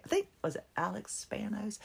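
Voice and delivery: unsure whisper to herself; whispered aside. Real, not acted. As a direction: drop to a whisper, half to yourself. Unsure aside about the name.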